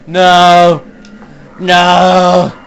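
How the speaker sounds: loud, high no of dismay; dismayed shout. Real, not acted. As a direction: cry out in protest. Dismayed cry in game.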